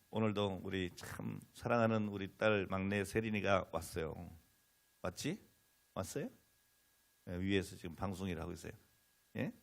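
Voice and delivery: ordinary delivery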